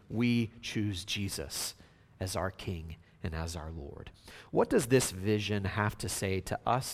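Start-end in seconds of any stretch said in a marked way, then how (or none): 0.1-4.1 lowered voice, deliberate and reverent; quiet declaration. Real, not acted. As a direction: let it drop to a hushed, solemn pledge. Hushed declaration after the acclamation.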